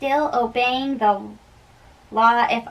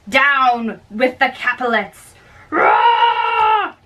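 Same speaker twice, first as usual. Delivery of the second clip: battle cry. Acted. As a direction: rouse the mob to attack. Crowd battle cry in brawl.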